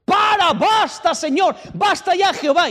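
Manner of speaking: high, strained yelled plea; acted prophet, desperate and high-pitched; shouting at God. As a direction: cry out to God to stop. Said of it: "empieza a gritarle a Dios".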